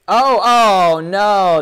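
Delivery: loud, high, drawn-out groaning cry; real dismay. Real, not acted. Commentator watching a drop that lost the battle, loud dismayed outcry. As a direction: wail as the move goes wrong.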